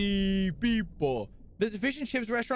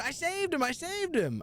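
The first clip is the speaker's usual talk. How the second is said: much higher, proud repeat; excited brag; 10 semitones higher than usual. Real, not acted. Streamer telling chat he rescued the beluga, excited higher brag. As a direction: crow it like a hero.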